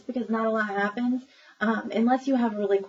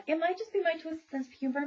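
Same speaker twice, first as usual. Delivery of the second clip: usual speaking voice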